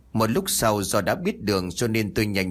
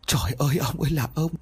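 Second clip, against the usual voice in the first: wailing higher, voice breaking with grief; crying wail; 5 semitones higher than usual. A narrator's reading. Widow weeping over her dead husband, wailing in grief. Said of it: "vừa khóc vừa kêu".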